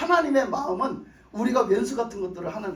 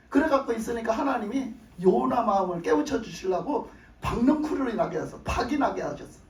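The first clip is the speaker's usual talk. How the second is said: ordinary delivery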